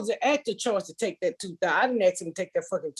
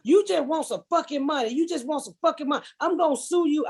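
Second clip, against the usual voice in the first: imitating angry ex; 7 semitones higher than usual. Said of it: "He goes".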